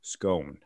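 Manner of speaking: "scone" said low and soft, rhyming with "bone"; long-O scone. Shown as a demonstration. Skeptical host tries the word, low "scone" rhymed with bone. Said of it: "that's how you pronounce".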